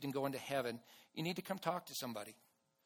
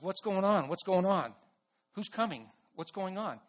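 raised-pitch, insistent questioning; urgent questions; 4 semitones higher, 5 dB louder than usual. Retold or imitated. Blind beggar asking the passing crowd what is happening, urgent.

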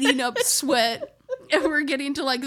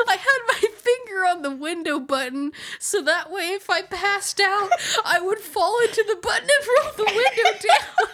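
quoting dad, rising high as laughter takes over; fighting giggles to finish his line; laughing through quote; 8 semitones higher than usual. Real, not acted. Quoting dad's finger-on-button plan, laughing harder. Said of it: "He's like".

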